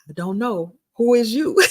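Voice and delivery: high, loud mock-confused teasing with a laugh; joking with a tearful fan, high and loud; playful teasing. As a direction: play dumb to make her laugh, pitch up high. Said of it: "I wanted to make her laugh".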